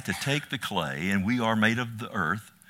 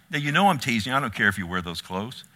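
ordinary talk, nothing marked

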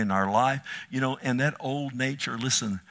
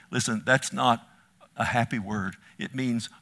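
ordinary talk

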